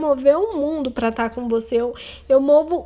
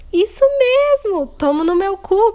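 higher, louder sarcastic outburst; bitter punchline; 5 semitones higher, 5 dB louder than usual. Real, not acted. Venting to listeners about being hurt, sarcastic outburst.

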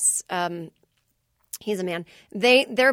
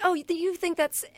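bemused; 9 semitones higher than usual. Retold or imitated. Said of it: "I was like".

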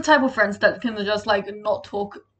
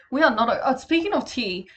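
ordinary delivery

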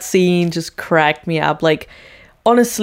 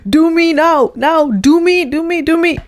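high, loud, breathless begging; eager plea; 10 semitones higher, 4 dB louder than usual. Retold or imitated. Suzanne begging the guru next, high and loud. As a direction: beg like she can't wait her turn.

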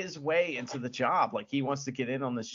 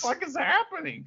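mock bewildered outburst; 11 semitones higher, faster than usual. Exasperated mock viewer reaction to editing.

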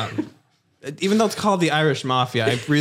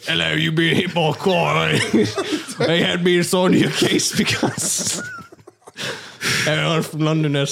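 put-on British accent; 5 semitones higher than usual. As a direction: play the doctor in a mock British accent. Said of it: "it's the same accent".

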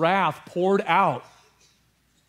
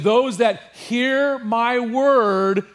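higher, louder and very slow; slow proclamation; 6 semitones higher, 5 dB louder, much slower than usual. Acted. Preacher quoting Jesus to his church, slow and loud. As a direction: proclaim every word deliberately. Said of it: "And he said".

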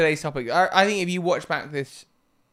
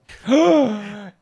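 surprised gasp; 5 semitones higher, 7 dB louder than usual. Real, not acted. Shocked at how late it is.

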